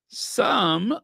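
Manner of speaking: "some" repeated high and held long; stress on SOME. Real, not acted. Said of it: "let me stress it".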